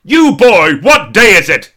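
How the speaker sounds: loud, high Victorian shout down to the street; shout from the window. Pretend. A man calls down from his window to a street boy. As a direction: bellow like Scrooge at a boy. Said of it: "open the window and shout".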